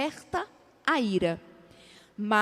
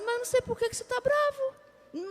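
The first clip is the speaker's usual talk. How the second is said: high, falsetto-like faux-innocent voice; mock innocent reply; 11 semitones higher, 4 dB quieter than usual. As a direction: play dumb in a sweetly provoking tone, much higher. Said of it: "o outro fala assim". (imitando com voz aguda e falsa inocência)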